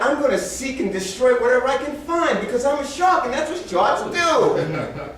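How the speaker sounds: high-pitched, playful predator's boast; shark's voice. Acted. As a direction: declare it as a relentless shark, drawing out the ending. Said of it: "They're like".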